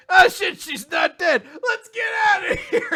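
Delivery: high, loud, laughing yell; laughing panicked shout. Retold or imitated. Hero realizing she's alive, laughing panicked shout. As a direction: scream it as you bolt, cracking up.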